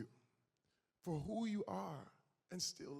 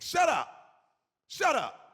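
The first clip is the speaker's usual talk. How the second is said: loud, higher mock shout of shut up; retold yell; 8 semitones higher, 12 dB louder than usual. Father yelling at daughter, reenacted loud shout. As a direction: bark it at her like the old angry dad.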